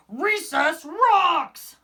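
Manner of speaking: high, loud, slow bellow; a booming joyful shark bellow. A narrator's reading. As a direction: bellow it across the playground. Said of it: "bellowed Clark the Shark".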